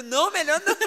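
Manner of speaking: high, loud mock panic with laughter; desperate father. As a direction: plead like a panicking dad, hammed up. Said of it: "o pai ficou desesperado".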